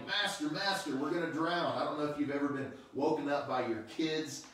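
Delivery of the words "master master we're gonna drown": high, loud cry of alarm; frantic higher, louder yell for help; panicked shouting. Retold or imitated. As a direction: shout it like men about to drown. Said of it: "They woke him up shouting".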